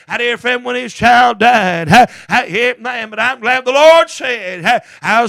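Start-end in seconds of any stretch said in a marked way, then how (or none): none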